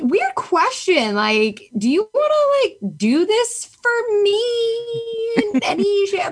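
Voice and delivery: high, drawn-out pleading; sheepish request. Retold or imitated. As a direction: ask a favor in a tiny, hesitant high voice. Retold sheepish request for help.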